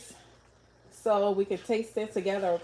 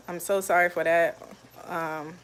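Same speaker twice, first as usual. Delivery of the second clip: usual speaking voice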